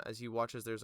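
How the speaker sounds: ordinary talk, nothing marked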